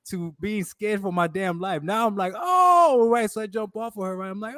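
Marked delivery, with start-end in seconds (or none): 2.4-3.3 high, loud retold "oh" of shock; startled yelp, hammed-up; mock alarm. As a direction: act out a sudden realization, high-pitched.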